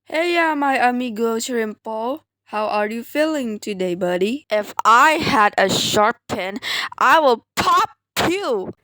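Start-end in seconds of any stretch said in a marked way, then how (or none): none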